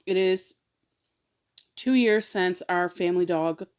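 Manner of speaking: usual speaking voice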